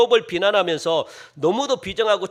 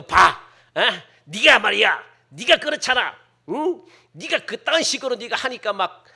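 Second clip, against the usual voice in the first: a hammed-up busybody scolding a struggling friend; mock scolding; 4 semitones higher, 4 dB louder than usual. Congregant piling on, scolding someone.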